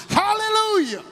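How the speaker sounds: very high, ringing shout; hollered hallelujah. Retold or imitated. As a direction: cry it out with joy. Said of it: "she hollered in the chapel".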